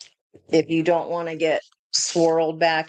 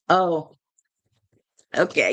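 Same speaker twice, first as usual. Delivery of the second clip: ordinary delivery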